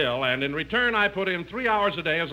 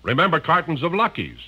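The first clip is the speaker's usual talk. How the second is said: hearty, even announcer pitch for cigarettes; commercial read; 5 semitones lower, 3 dB louder than usual. Acted. Radio announcer selling Luckies to listeners, hearty ad read. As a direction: sell the tobacco like a radio ad man.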